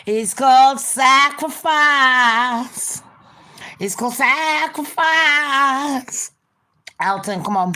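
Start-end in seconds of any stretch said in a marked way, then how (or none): 0.1-3.0 loud, high, very slow pronouncement; solemn leader. Pretend. Cult leader telling Ben he must die, loud solemn intoning. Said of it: "that is what we do as leaders". 3.8-6.3 higher solemn leader. Pretend. Cult leader repeating it to Ben, high eerie drawn-out chant. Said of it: "that is what we do as leaders".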